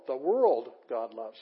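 WORLD stretched and loud, then "God loves" lighter; slow, emphatic fronted WORLD; stress on WORLD. Shown as a demonstration. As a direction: lean long on world up front. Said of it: "That's fronted".

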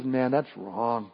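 understated gentle divine rebuke; God gently correcting. Retold or imitated. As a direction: say it slowly and gently, like a father correcting. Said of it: "God says".

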